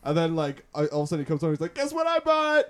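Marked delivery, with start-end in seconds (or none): 1.7-2.7 high, loud gleeful boast; high loud mock glee; mock excited brother. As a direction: sing it out like a proud kid.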